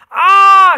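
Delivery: loud high scream of alarm. Acted. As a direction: shriek as you're pinned down. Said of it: "Shabana screamed".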